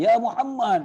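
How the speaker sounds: high shouted call of a name; mock shout from afar; mock yell. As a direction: call out loud and high across a crowd. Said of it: "dia jerit lah".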